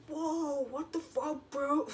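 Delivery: high, put-on bewildered voice; mock startled protest. Playing the guy punched out of nowhere, mock shocked.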